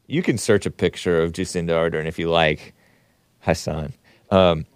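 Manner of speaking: normal talking voice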